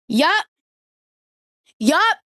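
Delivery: high, loud, proud "Yup"; a loud, triumphant "Yup"; emphatic yup. Real, not acted. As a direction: own it with defiant glee. Proud of trashing his book.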